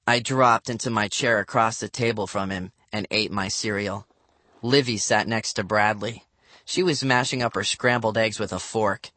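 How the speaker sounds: ordinary delivery, nothing marked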